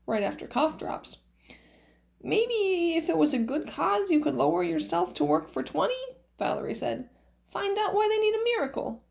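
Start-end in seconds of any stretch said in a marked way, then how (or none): 2.2-5.9 put-on high, lilting old-woman voice; high swooping persuasion; Valerie, wheedling. Valerie coaxes Max to relent. 7.5-9.0 put-on very high old-wife voice; high-pitched insistent nudge; Valerie, urging. Valerie urges Max on.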